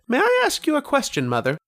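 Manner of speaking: put-on high, quick boyish voice, eager to ask; a ten-year-old boy politely asking; young boy. Ten-year-old boy asking his mother a question, high boyish voice.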